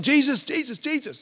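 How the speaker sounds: high-pitched mock yell, not full volume; imitated yelling. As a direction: call out insistently, pitched well up, as the blind beggar. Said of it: "And he's yelling".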